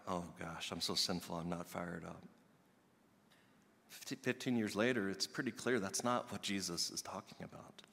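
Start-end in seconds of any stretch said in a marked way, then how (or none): 0.1-2.2 softer, lower, ashamed; hammed-up despairing murmur; mock guilty mumble. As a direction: sink into mock self-pity. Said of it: "that roundabout way".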